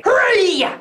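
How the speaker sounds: ordinary delivery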